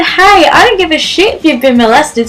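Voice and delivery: ordinary talk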